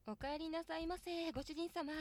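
sweet maid greeting, lightly playful; maid cafe greeting. Pretend. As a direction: greet him like a shy maid. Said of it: "なんて。".

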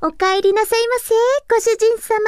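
high, sweet maid cafe welcome; sugary maid cafe greeting. Acted. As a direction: chirp it like a cute cafe maid. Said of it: "キャラになりきるって難しいね".

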